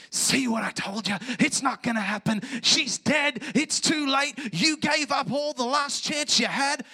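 loud, rising accusing shouts; acted jeering tirade at full force; hell's taunting. Acting hell taunting Jairus.

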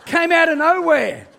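high and loud, laughing through the words; can't stop laughing at the memory; laughing loudly. Real, not acted. Laughing while retelling the shout.

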